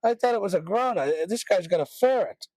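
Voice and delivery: high, slushy mock customer; imitating Alexa user. Customer complaining to Alexa about the ferret, mock indignant voice. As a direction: whine it up high, comically indignant. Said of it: "people in the shop asking".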